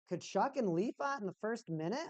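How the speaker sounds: normal talking voice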